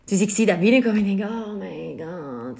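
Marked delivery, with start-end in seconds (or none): 1.3-2.6 exasperated under breath. Retold or imitated. Reading his messages, exasperated.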